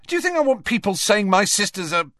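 fast, high, indignant outburst; angry protest. Acted. Ron rowing with his sister Ginny, angrily. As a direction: rush it out angrily, high-pitched. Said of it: "just as angrily".

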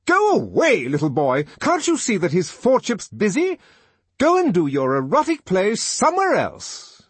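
high, very loud scolding; furious high-pitched telling-off; angry shout. A narrator's reading. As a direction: shout an irritated order at a child. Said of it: "shouted the D H C angrily".

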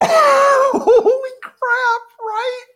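high, squealing laugh through the words; laughing and crying out in shock; shocked laughter. Real, not acted. Hearing the inmate's crime, squealing with shocked laughter. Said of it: "that's not what I was expecting".

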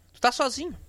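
normal talking voice

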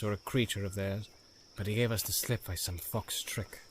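a hint of a measured, stern leader; Faramir, stern captain. Faramir voiced in read story.

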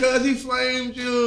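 high, slow mocking exclamation; mock reaction. Joining the cohost's teasing skit, high slow mock exclamation. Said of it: "I'm like".

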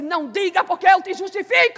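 loud, high preached proclamation; preacher proclaiming the Lord's reply, loud; preaching shout. Acted. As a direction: declare the Lord's word with force.

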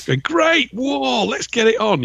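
high, drawn-out gung-ho cheer; mock eagerness. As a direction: play a soldier thrilled to join in. Mimics soldiers eager for battle.

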